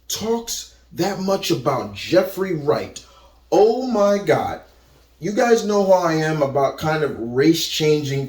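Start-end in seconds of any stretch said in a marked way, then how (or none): none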